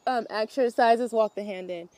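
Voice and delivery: ordinary talk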